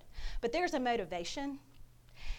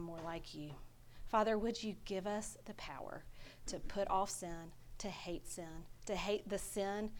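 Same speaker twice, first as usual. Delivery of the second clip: soft, earnest prayer voice; closing prayer; 2 semitones lower, 5 dB quieter than usual. Acted. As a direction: pray quietly over the congregation.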